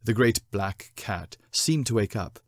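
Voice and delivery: plain reading voice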